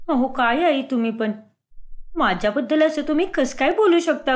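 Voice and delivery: acted hurt protest, building to a firm refusal; indignant, aggrieved young wife voice; wounded daughter-in-law. Swati vows to mother-in-law she won't eat, hurt.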